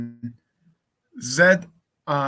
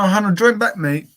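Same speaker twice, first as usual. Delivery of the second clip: calling out; 6 semitones higher, 8 dB louder than usual. Real, not acted. Calling friend to rejoin.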